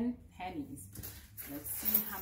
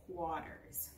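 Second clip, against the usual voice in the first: normal talking voice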